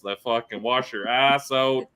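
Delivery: ordinary delivery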